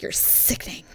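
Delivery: low-volume, voiceless jab; whispered disgust. Retold or imitated. Last jab at the jealous boyfriend, whispered in mock disgust. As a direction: re-enact a contemptuous whisper.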